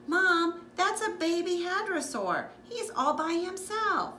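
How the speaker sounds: put-on high, eager child's voice; excited child. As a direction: point it out to mom, thrilled. Child spots lost baby dinosaur.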